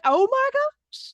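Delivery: high, slow, drawn-out oh my gosh; amazed gasp. Retold or imitated. Retelling her own amazement, high and slow. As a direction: let the realization hit you. Realizing the point, exclaiming.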